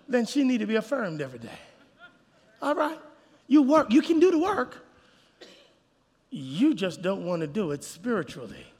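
usual speaking voice

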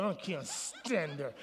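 a low, slow, soft grumble; muttered grudge. Retold or imitated. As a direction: slowly mutter it under your breath. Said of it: "you're like".

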